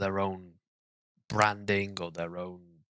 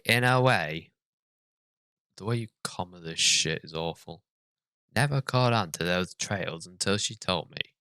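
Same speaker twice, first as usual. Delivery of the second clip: normal talking voice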